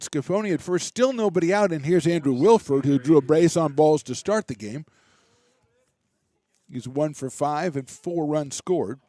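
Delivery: brisk, lively baseball call; live play-by-play. Real, not acted. Radio announcer calling the inning for listeners, lively play-by-play. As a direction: keep the game moving for listeners.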